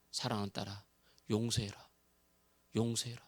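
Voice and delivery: low, soft, tender divine voice; hushed voice from above; voice of the Spirit. Acted. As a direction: speak as God, softly and gently. Holy Spirit voiced in testimony.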